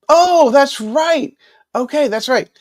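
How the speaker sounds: light up as the memory comes back; sudden realization. Real, not acted. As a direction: pitch jumps up in happy recognition. Suddenly remembers from a live comment.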